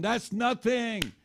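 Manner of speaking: high, slow "That's nothing" with a falling end; drawn-out dismissal. Shown as a demonstration. Preacher to congregation, high, slow drawn-out dismissal. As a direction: stretch "nothing" out high and let it fall.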